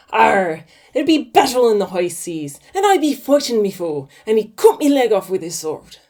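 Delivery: loud, gruff mock pirate; hammed-up pirate telling his tale. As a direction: growl it like a storybook pirate. Pirate voice in told joke.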